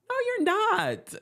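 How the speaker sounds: very high, slow no you're not; squeaky mock retort. Host answering the drunk guest, very high. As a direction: squeal it in disbelief.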